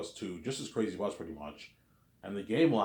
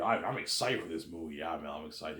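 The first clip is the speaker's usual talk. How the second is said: ordinary talk, nothing marked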